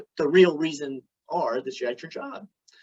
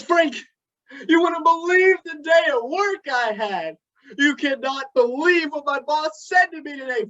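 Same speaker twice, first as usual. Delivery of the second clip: a higher, upset wife's voice; Cindy's voice; 7 semitones higher than usual. Retold or imitated. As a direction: vent like a wife fed up with her boss. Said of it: "and says".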